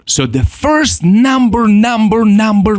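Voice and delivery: emphatic repeated number; stressing number. Shown as a demonstration. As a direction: hammer the first number home. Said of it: "emphatic lesson point".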